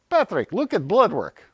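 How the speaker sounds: high, loud Russian coach giving an order; lightly done Russian coach accent. Retold or imitated. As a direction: imitate the Russian coach's broken English. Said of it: "So she says".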